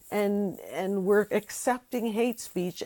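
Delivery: ordinary delivery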